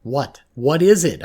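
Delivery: high, loud alarmed demand; yelled. A narrator's reading. Girl demanding answers from her shocked counsellor, panicked yell. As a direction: yell the question, desperate for an answer. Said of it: "I yelled".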